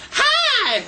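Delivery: very high, loud mock girlish squeal. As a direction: shriek a greeting like a party girl. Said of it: "I could hear that shit".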